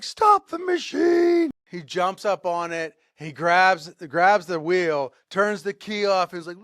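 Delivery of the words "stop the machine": high, loud, stretched-out shout; desperate yell. Retold or imitated. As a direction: yell it slowly over the machine. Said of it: "He's yelling at her".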